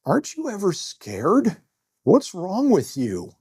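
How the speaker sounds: high, slow shocked female teller. Retold or imitated. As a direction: play the teller's high disbelief. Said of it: "she goes".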